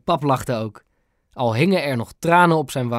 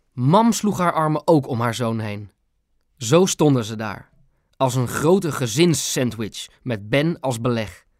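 ordinary delivery

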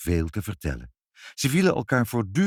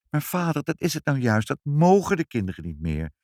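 mild mother's lament, raised pitch falling away; lightly voiced sorrowful protest; sad exclamation; 7 semitones higher than usual. A narrator's reading. Mother tells father what children may no longer do, mildly sad. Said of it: "riep moeder treurig uit".

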